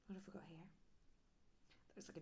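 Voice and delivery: soft whisper. Real, not acted. Reader whispers to the child at the zoo. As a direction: lean in and whisper the question.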